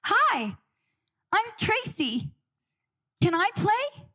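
put-on forced-cheerful child with a plastered-on smile; scared little girl being brave. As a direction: chirp a bright hello while scared inside. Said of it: "I plaster a smile on my face, and I just say".

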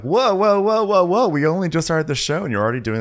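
high, loud mock alarm; alarmed protest. Viewer objecting to early Top Chef eliminations, alarmed protest. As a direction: halt them with rising disbelief.

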